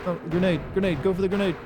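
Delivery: urgent high callout; grenade warning yell. Real, not acted. Calling out a grenade in game.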